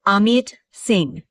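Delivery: usual speaking voice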